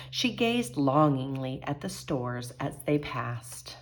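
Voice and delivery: lively picture-book read-aloud; animated storytelling to children; story narration. A narrator's reading. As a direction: read it like a bedtime story for kids.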